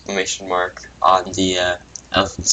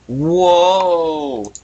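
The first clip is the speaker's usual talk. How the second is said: higher, louder cartoon whoa; amazed whoa; 8 semitones higher, 6 dB louder than usual. Philip amazed by the giant elephant, a loud high whoa. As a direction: blurt it out amazed.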